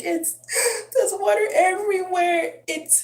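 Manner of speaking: imitating a scared kid in tears; panicked high wail, breath catching; sobbing child. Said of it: "He's like".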